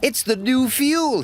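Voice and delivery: alarmed cry. Acted. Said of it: "he cried".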